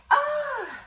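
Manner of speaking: very high excited "ah"; excited squeal. Real, not acted. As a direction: squeal with delight at the card. Reacting to the drawn card.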